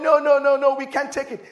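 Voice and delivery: loud, high, repeated refusal; acted protest. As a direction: protest like an alarmed crowd. Acted crowd refusing Joshua and Caleb.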